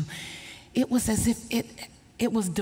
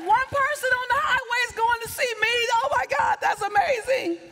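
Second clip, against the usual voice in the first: high emotional cry; 13 semitones higher, 4 dB louder than usual. Retold or imitated. A stranger on the highway came to see her, high tearful cry. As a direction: voice high and straining with tears. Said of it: "I just start crying".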